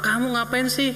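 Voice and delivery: annoyed mother. Retold or imitated. Preacher voicing an irritated mother to the congregation, mock annoyance. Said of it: "Emaknya marah".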